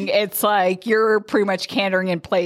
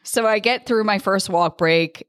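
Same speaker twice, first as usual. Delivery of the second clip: normal talking voice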